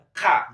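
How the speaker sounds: "K." said alone and loud, a single clear consonant sound; letter demo. Shown as a demonstration. Teacher to online class, showing the sound "K." loudly. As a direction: call out "K." crisply on its own. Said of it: "solamente leemos K".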